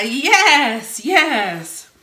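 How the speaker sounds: loud high exultant yes; fired-up preacher yes; excited yes. Real, not acted. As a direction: celebrate the shout. After congregation shouts.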